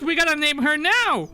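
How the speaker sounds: high and loud, thrilled to name her; excited. Real, not acted. Excited naming of character.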